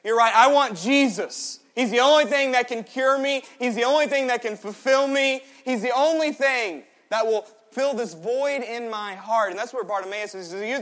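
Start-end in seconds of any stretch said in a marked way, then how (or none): 0.0-9.5 high, loud, impassioned declaration; fervent confession. Acted. Believer moved by a sermon, crying out passionately. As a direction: proclaim it like a sudden conviction.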